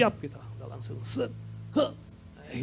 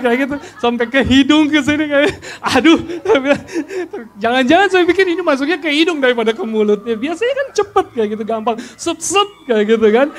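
higher voice, chuckling as he tells it; can't hold back laughing at the memory; laughing through it; 7 semitones higher, 4 dB louder than usual. Real, not acted. Father to the church laughing at the bottle mishap.

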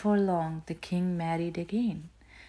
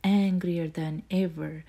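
usual speaking voice

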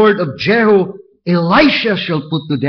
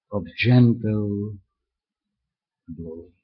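hushed gentle blowing; 7 semitones lower, 7 dB quieter than usual. Acted. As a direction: let the words fade like a breeze.

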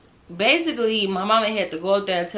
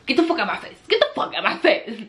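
laughing retort; 5 semitones higher, 3 dB louder than usual. Retold or imitated. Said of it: "'Cause it's like".

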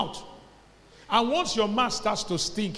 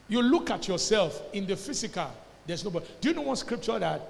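usual speaking voice

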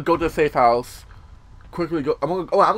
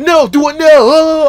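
high, loud mock dad yelling orders; mock yell of a bossy father; imitating shouting dad; 12 semitones higher, 15 dB louder, slower than usual. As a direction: bark orders like a testosterone-fueled dad. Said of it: "barks orders".

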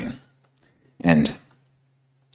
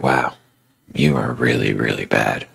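lower, mocking voice sinking down; accusing inner voice; 6 semitones lower than usual. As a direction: sneer like the voice of guilt. Accusing voices in her head.

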